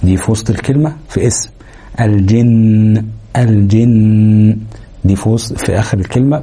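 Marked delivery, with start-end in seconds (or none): 2.0-3.0 الجن ending in a long, level nasal hum; held ghunna. Shown as a demonstration. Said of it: "هنتك على النون شوية". 3.4-4.6 الجن slowly, final ن hummed on and on; held ghunna. Shown as a demonstration. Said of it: "هنتك على النون شوية".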